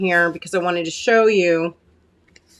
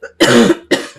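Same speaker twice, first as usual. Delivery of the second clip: a hard, rough clearing of the throat; real throat clear, struggling to get the voice back; 9 semitones lower, 6 dB louder than usual. Real, not acted. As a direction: clear a blocked throat loudly. Host's voice catching mid-demo.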